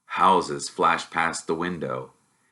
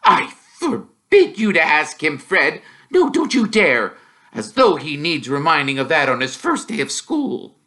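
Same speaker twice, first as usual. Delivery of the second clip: firm, louder forbidding tone; a mum laying down the law; stern mum scolding her son; stern mother; 9 semitones higher, 5 dB louder than usual. Acted. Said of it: "suddenly became very stern".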